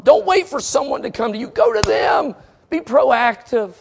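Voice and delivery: high, urgent pulpit exhortation; full-force preaching. Real, not acted. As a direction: urge the congregation into action.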